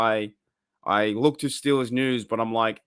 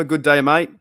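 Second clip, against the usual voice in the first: Good day, mate with a flat American read, loud; saying Good day, mate like a non-Australian, loud; non-Australian g'day; 3 semitones higher, 7 dB louder than usual. Shown as a demonstration. Replying to a chatter's g'day in a non-Australian accent. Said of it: "I said that like not Australian".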